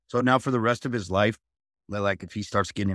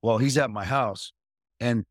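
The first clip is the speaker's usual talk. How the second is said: ordinary delivery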